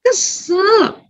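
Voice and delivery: put-on high, pouting kid voice; one sulky high word from a child. Child naming feeling.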